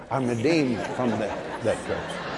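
very low, soft, unshakeable; calm firm assurance. Retold or imitated. Preacher repeating his answer to the scared person, calm low assurance. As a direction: say it deep and quietly certain.

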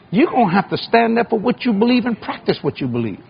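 high, ringing call to action; fiery preaching. Acted. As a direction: rouse the congregation to rise up. Preaching at full force.

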